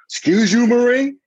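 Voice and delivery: high, loud indignant retort; mock outrage. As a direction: sound offended at the insolence.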